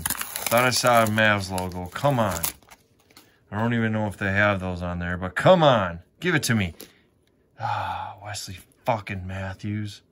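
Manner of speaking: ordinary talk, nothing marked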